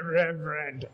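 low, soft scornful Reverend. Retold or imitated. Airport clerk sneers at the preacher in jeans, low soft scorn. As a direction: drop the voice into a sneer. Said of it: "so she looked at me and said".

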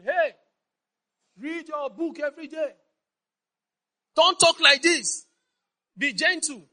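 high, loud scolding parent; a pretend parent lecturing loudly; mock parent scolding. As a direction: bark orders at the kids like a strict parent. Preacher voicing parent scolding children.

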